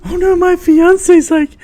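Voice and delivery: mock him with a high nagging whine; hammed-up imitation of a henpecked friend; mocking whiny voice. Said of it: "he's like".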